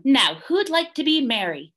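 high, brisk organiser; bossy Emu. A narrator's reading. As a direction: call for the next role. Emu runs the auditions.

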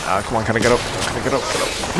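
usual speaking voice

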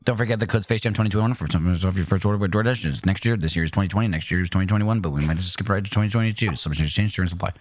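rapid, low monotone fine print; ad disclaimer. Acted. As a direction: rattle off the terms and conditions, low and fast. Reads ad disclaimer.

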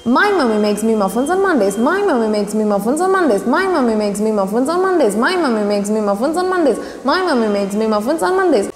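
fast, repeated run of the twister; fast tongue twister. Shown as a demonstration. Teacher racing through the twister for students, fast. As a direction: rattle it off quickly. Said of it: "let me try for you".

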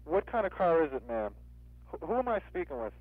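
ordinary delivery

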